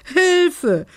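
high, loud cry for help; frightened scream. A narrator's reading. Belinda cries for help as the house shakes, screaming. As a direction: scream it out, pitch leaping right up. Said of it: "schrie Belinda".

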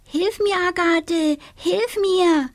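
high whining plea; little witch whimpering for help. A narrator's reading. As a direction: wail like a stuck little witch. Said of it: "jammerte die kleine Hexe".

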